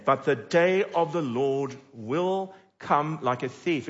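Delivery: slow, weighty Bible line; solemn reading of the verse; scripture reading. Acted. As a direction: let the warning land slowly. Reads scripture aloud.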